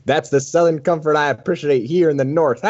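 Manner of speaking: a gentle Southern twang for the joke; slight southern accent. Acted. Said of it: "slight southern accent".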